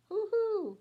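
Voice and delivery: high, loud owl hoot. A narrator's reading. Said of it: "He writes in his notebook".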